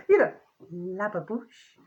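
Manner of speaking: lababouche drawn out slowly, la-ba-boosh; savouring a word. Shown as a demonstration. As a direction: linger on lababouche, slow and fond. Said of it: "It's got a bit of a, a twang to it".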